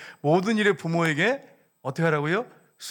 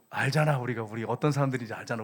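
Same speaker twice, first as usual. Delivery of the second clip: ordinary talk, nothing marked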